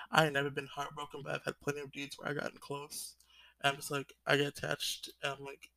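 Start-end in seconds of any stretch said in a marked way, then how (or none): none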